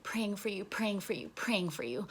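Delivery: soft, repeated sing-song taunt; hushed mock prayer; mock piety. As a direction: murmur it like a fake blessing. Mockingly handing over a note.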